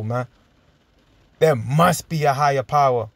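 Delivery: normal talking voice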